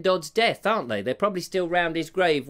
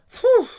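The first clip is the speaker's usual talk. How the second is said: hot phew; 8 dB louder than usual. Real, not acted. Too hot by the fire.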